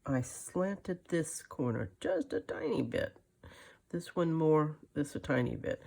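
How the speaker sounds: ordinary delivery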